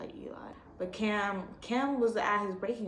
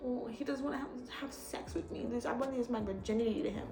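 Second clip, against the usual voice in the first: mock whiny girl; 3 semitones higher, 7 dB quieter than usual. Mocking Ashley's complaint, soft whiny imitation. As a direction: whine it like the annoying character.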